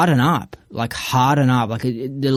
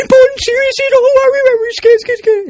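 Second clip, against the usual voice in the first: much higher, laughing mock whimper; mock scared; 7 dB louder, slower than usual. Mocking fearful people's energy, high laughing whimper. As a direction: whimper and sob like a frightened wimp. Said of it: "this is what I perceive the energy from them".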